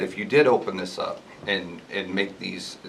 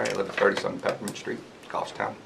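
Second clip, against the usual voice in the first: unclear address; 6 dB quieter than usual. Real, not acted. Resident first introducing himself to the board, unclear and quiet. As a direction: state your address quietly, without projecting. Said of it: "Everybody has to speak clearly".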